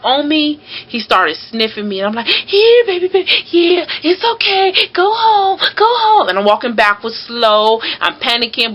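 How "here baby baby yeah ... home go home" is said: scared coaxing. Retold or imitated. Coaxing a huge dog, scared.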